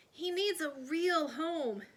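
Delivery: put-on girl pleading for the crocodile; crying out. A narrator's reading. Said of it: "cried Alice Jane".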